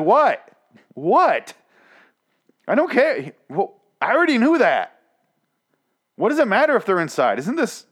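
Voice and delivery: high, exasperated ranting; outraged disbelief. Retold or imitated. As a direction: protest incredulously, pitch leaping up. Retold outraged reaction to the scene.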